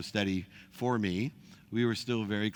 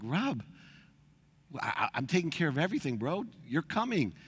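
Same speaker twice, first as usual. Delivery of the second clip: high, fast, insistent coaxing; pushy retold insistence; 5 semitones higher than usual. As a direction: talk the friend into coming, pitched up. Insisting friend come along.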